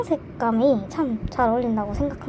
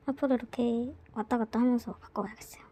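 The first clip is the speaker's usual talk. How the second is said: whisper; 7 dB quieter than usual. Real, not acted. Quiet aside while unboxing.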